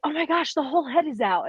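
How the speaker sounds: high-pitched, thrilled mock narration; a touch of breathless wonder; excited husband. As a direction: gush like an excited new dad. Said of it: "he is so excited about it".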